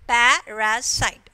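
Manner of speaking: "Parasite" slowly, syllable by syllable; three sound units. Shown as a demonstration. Teacher showing three units in a word, "Parasite" split. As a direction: say "Parasite" as pa, ra, site. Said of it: "three unit of sound is there".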